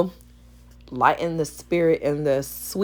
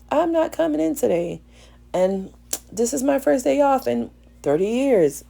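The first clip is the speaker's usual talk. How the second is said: high quoted voice; 8 semitones higher than usual. Retold or imitated. Voicing a worker calling in.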